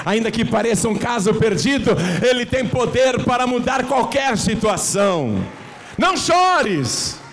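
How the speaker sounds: building to a high, loud shouted climax; preaching at full force. Real, not acted. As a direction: rise and rise, then cry out.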